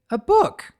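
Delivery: high, loud delighted cry; light up at the gift; thrilled exclamation; gleeful. A narrator's reading. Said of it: "said in glee".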